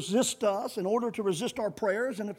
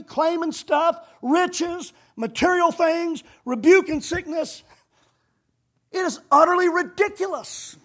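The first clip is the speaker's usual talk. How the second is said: raised, indignant pulpit delivery; higher, punched denunciation with weighty pauses; forceful preaching; 5 semitones higher, 6 dB louder than usual. Real, not acted. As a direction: preach it out hard, condemning.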